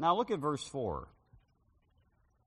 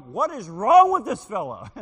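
baffled exclamation; 6 semitones higher, 15 dB louder than usual. Retold or imitated. Preacher's puzzled reaction retold.

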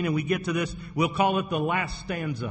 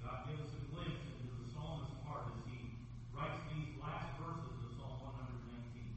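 much softer, hushed, intimate prayer voice; 2 semitones lower, 19 dB quieter, faster than usual. Acted. Pastor praying over the Wednesday gathering, hushed and earnest. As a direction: pray quietly, as if close to God. Leads congregation in evening prayer.

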